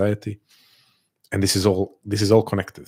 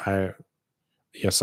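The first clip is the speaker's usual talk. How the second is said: ordinary delivery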